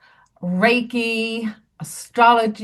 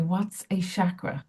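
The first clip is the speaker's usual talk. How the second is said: hushed question; 3 semitones lower, 8 dB quieter than usual. Retold or imitated. Whispered question to neighbour.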